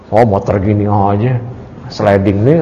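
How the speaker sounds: low, quick scoffing at a beat-up bike, laughing through it; mock put-down of a friend's bike; dismissive mock sneer. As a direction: brush it off like junk, with a laugh. Mocking someone's beloved motorbike.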